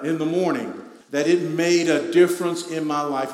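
loud preaching of a self-deceiving voice; fervent pulpit build. Acted. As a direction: build through each because. Preaching the inner voice.

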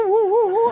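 high hammed-up gorilla woo calls, laughing; gorilla hooting. Jobless man in the gorilla suit, mock ape hoots. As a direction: hoot like an ape beating its chest. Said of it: "you have to beat your chest and woo".

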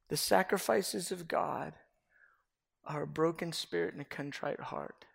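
slow, softening into a whisper; reverent reading. Real, not acted. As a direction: read the psalm as a quiet prayer.

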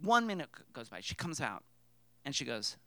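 normal talking voice

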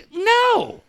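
high, emphatic mock refusal; retold high-pitched scolding; imitated yelling. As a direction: imitate an appalled friend's no. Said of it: "don't need the other one yelling at you".

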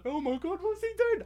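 high shocked disbelief; incredulous. Retold or imitated. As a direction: gasp it out in shock. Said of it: "I was incredulous".

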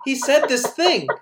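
high-pitched incredulous comeback; exasperated disbelief. Retold or imitated. Answering commenters who doubt a quote, exasperated. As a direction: argue back, exasperated and baffled.

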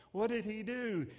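ordinary delivery

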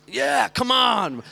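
high, slow, hyped come on; excited. Real, not acted. Pastor hypes up his friendship with Dan. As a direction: cheer for the bromance.

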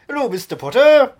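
a hint of Rickman's Snape; Alan Rickman impression. Shown as a demonstration. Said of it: "I'm Alan Rickman".

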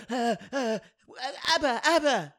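high, halting mock girl voice; lightly voiced tipsy girl; mimicking drunk girl. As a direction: stammer it like a tipsy punter. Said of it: "scurried up and went".

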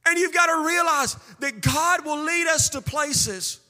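high, driving, repetitive sermon cadence; impassioned preaching. Acted. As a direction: hammer it home to the congregation. Preaches at full force.

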